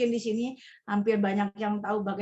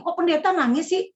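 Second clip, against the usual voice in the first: higher, louder nosy tone; mocking busybody; 7 semitones higher, 5 dB louder than usual. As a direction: wag a finger like a gossip at the funeral. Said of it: "Banyak orang yang".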